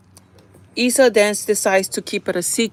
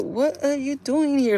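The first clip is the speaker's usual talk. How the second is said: higher, slow imitation of a baffled friend; mock confusion; 5 semitones higher, slower than usual. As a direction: stretch the question out in exaggerated puzzlement. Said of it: "Like, all confused and stuff".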